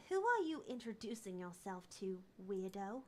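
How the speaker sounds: ordinary talk, nothing marked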